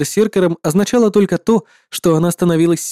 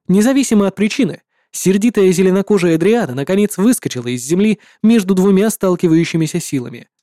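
plain reading voice

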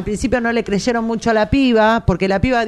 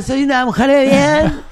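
put-on louder, higher posh Argentine accent; 6 semitones higher, 4 dB louder than usual. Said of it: "el tono de cheta". (acento cheto argentino)

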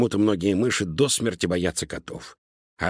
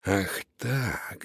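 soft, ominous reaction; quietly threatening, held-back anger; menacing rat; 7 dB quieter than usual. A narrator's reading. Rat learns mice ate a sausage, quietly menacing.

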